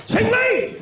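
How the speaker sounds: high, loud yell of a friend's name; calling out. Acted. High loud yell at a friend who seems asleep. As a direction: shout to wake him.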